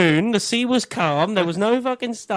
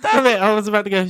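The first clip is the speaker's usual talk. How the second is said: ordinary delivery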